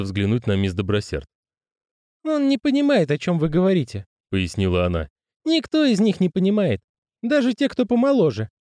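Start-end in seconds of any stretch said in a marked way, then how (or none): 2.2-8.5 high woman's voice; female character voice. Acted. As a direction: voice her high and patient. Miss Dobroserd voice.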